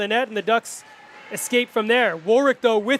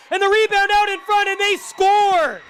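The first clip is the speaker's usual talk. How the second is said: goal call; 8 semitones higher than usual. Real, not acted.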